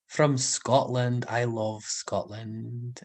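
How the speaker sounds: slow, put-on Scottish accent. Shown as a demonstration. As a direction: try out a Scottish accent, taking it slowly. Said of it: "Is that a good Scottish accent".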